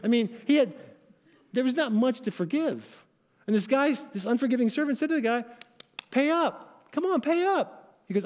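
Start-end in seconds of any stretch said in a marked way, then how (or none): 6.1-7.9 higher-pitched, mildly acted demand for payment; demanding creditor. Unforgiving servant to the man who owes him, demanding. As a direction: press impatiently, pitched up, as a story's creditor.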